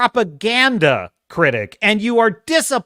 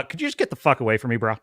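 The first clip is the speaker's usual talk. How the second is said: fast quiet brush-off; 9 semitones lower, 6 dB quieter, much faster than usual. Retold or imitated. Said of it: "I'd be like".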